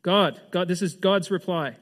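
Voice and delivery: ordinary delivery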